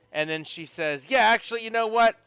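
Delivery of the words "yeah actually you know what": higher, louder burst of resolve; fired-up. Acted. Girl takes on goblin wrestling, fired-up. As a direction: psych yourself up for a fight.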